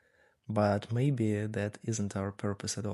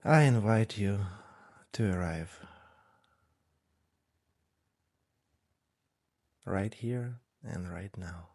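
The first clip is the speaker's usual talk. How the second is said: guided meditation. Real, not acted. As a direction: ease them gently into stillness, unhurried. Leads a breathing meditation.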